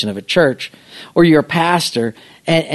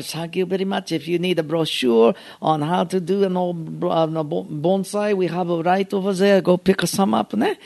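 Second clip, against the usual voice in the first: mock Japanese accent, polite and softer; imitated bonsai master; 2 semitones higher, 4 dB quieter than usual. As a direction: play a bowing Japanese demonstrator. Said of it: "and then he bowed".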